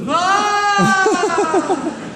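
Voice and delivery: high loud scream for a thousand-foot fall; long falling scream. Shown as a demonstration. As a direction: scream longer, falling far. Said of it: "It's a thousand-foot cliff".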